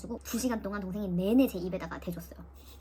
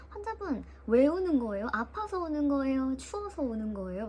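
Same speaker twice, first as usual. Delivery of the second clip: nurse asking; 4 semitones higher, slower than usual. Retold or imitated. Nurse asks why she cries.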